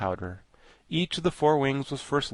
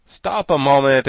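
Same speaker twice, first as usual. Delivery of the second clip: loud exclamation; exclaiming; 3 semitones higher, 11 dB louder than usual. A narrator's reading. Jack interrupts before the Thing is brought to life, exclaiming. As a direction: call out loudly to halt them. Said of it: "exclaimed Jack".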